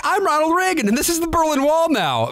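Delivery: high-pitched mock cartoon kid; Leo. Host mimicking the cartoon boy playing pretend, high kid voice. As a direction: put on a high kid-at-play voice. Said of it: "Leo's like".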